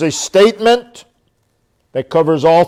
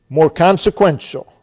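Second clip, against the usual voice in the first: ordinary talk, nothing marked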